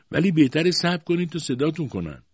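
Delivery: high, loud, peremptory order; bossy aunt. A narrator's reading. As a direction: put the doctor in his place. Aunt orders doctor to wait.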